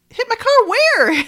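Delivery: high, slow mock disbelief; incredulous. Retelling the car owner questioning the stranger, incredulous.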